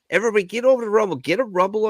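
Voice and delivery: high, fast hype plug; hyped shout to the viewers; excited call-out. Real, not acted. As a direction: rush it out like a pumped-up promo. Host urging viewers excitedly.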